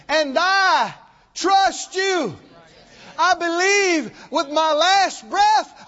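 loud, high, impassioned preaching cry; full-force preaching. Acted. Preacher to his congregation, praying at full shouting force. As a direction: pour it out to God at the top of your voice.

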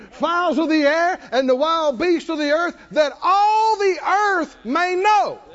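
building from firm to high shouting; defiant battle cry. Retold or imitated. David cursing back at the Philistine, building to a shout. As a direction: rise to a roaring challenge. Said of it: "he said".

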